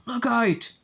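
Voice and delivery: usual speaking voice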